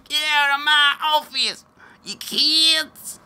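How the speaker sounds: high, hammed-up grumpy shout-voice; mocking Feinstein throwing kids out; mock cranky senator. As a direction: shoo the kids off in mock exasperation. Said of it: "I love Dianne Feinstein's".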